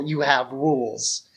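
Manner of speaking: normal talking voice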